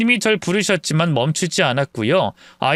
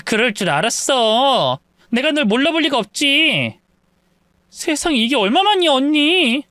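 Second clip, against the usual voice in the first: higher-pitched, glad recognition; delighted reunion; 8 semitones higher than usual. Acted. Old acquaintances meeting at the mart, delighted. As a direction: greet a long-lost friend with rising delight.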